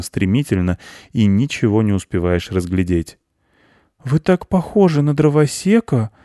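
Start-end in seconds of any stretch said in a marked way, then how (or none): 4.0-6.1 put-on high child's voice, surprised; surprised boy. A narrator's reading. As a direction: wonder aloud like a little boy. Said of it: "удивился мальчик".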